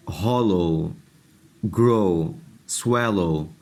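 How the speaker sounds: each word ends in a long o, as in ہالو said hɔlo; teacher demonstrating o plus w words; word list demo. Shown as a demonstration. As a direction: read slowly one word at a time. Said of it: "جیسے اب آپ الفاظ دیکھیں".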